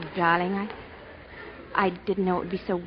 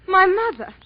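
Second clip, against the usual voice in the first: dismayed surprise; 9 semitones higher, 5 dB louder than usual. Acted. Sees her mother arriving by boat.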